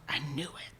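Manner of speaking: breathy hushed whisper, barely audible; stunned, hushed half-whisper; whispering to himself. Retold or imitated. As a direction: whisper it under your breath in disbelief. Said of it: "I could hear him whispering to himself".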